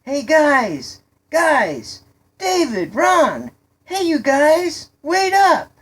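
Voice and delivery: high, loud calling after friends; desperate shout. A narrator's reading. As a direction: yell for them to wait, climbing in pitch. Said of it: "held back a little and shouted".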